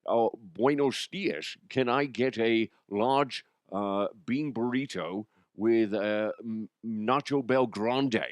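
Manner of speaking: slow Scottish star mangling Spanish; Sean Connery. Acted. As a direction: order deliberately in a thick Scottish burr. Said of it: "how I learned how to do Connery".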